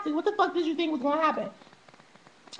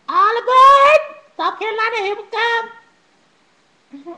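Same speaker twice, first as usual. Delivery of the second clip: loud, high conductor-style shout; playful boarding call; 6 semitones higher, 10 dB louder, slower than usual. Pretend. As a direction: holler it out like a train conductor. Playful train call to next destination.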